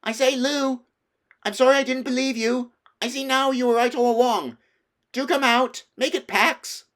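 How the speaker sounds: high, loud pleading calls; shouting apology. A narrator's reading. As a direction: shout an apology across the wood. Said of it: "So he shouted again".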